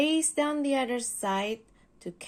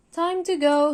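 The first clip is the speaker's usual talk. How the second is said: loud higher call; 5 semitones higher, 10 dB louder than usual. Acted. Driver calling the sleeping engine, loud call. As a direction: call Spencer to leave. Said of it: "he said".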